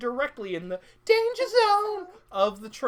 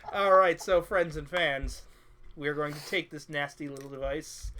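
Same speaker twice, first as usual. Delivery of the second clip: showman announcer; 5 semitones lower than usual. Acted. Sideshow host address.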